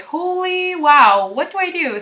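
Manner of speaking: high, drawn-out amazement into a helpless question; mock overwhelmed. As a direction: stretch the wow, playfully at a loss. Acting out being overwhelmed.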